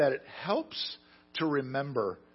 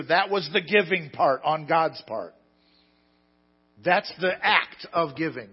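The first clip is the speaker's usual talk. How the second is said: higher, louder preaching build; fiery preaching; 6 semitones higher, 11 dB louder than usual. Acted. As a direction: proclaim it to the whole congregation. Preaching climax to congregation.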